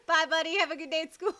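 high-pitched mock mom voice; mom's goodbye. Mom dropping her kid at school, mock sweet.

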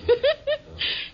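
giddy gossipy giggle high up; high, delighted giggle; high tittering laugh. Acted. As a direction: cackle at the scandal. Gossiping old lady laughs.

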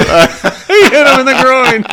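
high, loud, slow laughing cry. Real, not acted. Friend laughing hard at the groin hit, high and slow. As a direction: squeal it through real laughter.

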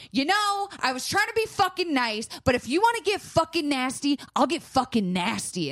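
high, heated retold snap at a stranger; mock-furious confrontation; angry outburst.

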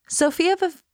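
"Sophia of" sung high, sinking sadly; sad droop. Shown as a demonstration. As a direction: let the voice lose the will to live. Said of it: "that sound is so sad".

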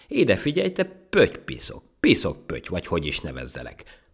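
loud, high start, then dropping; scolding. Acted. Monkey groping for a name for the ant, scolding. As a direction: lay into the little nuisance.

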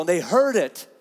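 ordinary talk, nothing marked

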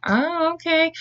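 high, wary acknowledgement; imitated uneasy okay. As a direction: play along, high and unsure. Boys react uneasily to the knife.

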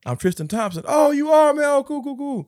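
hammed-up triumphant yelling; excited shout. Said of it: "They're like".

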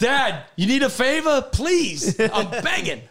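high, loud pleading with a laugh; mock begging. Son mockingly begging father for a favor. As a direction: beg dad, half laughing.

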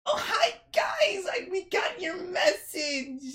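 very high, squealing hello; excitable small woman greeting. Acted.

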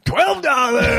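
high, laughing announcement of a small win; giddy reveal. Retold or imitated. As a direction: blurt it out through a laugh, delighted. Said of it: "And I go".

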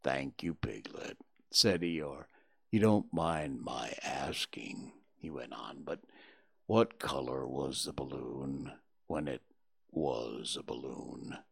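put-on droop every word like a sad old donkey; low sad donkey murmur; gloomy Eeyore. A narrator's reading. Eeyore voiced in whispery gloom.

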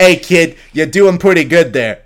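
loud New York guardian angel; New York accent. Retold or imitated. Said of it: "Is that accent fake?".

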